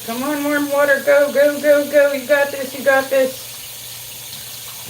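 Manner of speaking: root for the tap like a coach; cheering on. Pretend.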